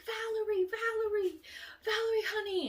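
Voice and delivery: high, loud frantic calling; mom screaming a name. Retold or imitated. Mother screams her daughter's name through the crowd, high and frantic. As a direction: call your lost child through a crowd. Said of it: "screaming through the crowd".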